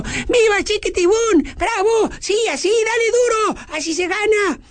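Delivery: put-on high excited children's cheers; cheering kids. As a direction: cheer wildly from the crowd. Children cheering their friend on. (niños vitoreando con euforia)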